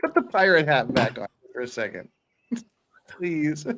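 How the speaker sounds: ordinary talk, nothing marked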